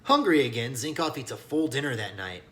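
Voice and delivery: plain reading voice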